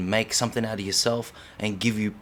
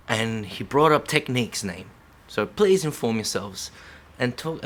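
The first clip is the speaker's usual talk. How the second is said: ordinary delivery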